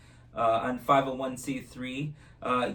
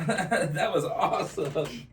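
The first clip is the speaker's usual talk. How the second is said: laughing; 6 semitones higher, slower than usual. Real, not acted. Laughs after trick.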